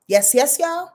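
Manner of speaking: usual speaking voice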